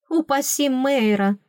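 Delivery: usual speaking voice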